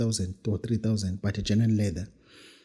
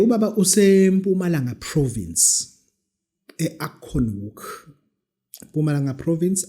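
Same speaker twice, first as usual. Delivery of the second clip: ordinary delivery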